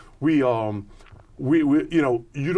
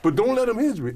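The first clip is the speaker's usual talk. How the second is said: fast, high and louder panic; frantic rush; 9 semitones higher, 4 dB louder, faster than usual. Retold or imitated. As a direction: rattle it off in a hurry. Retold frantic plea before jump.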